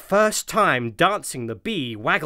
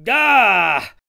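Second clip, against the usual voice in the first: startled scream; 6 semitones higher, 8 dB louder than usual. A narrator's reading. Sudden scream.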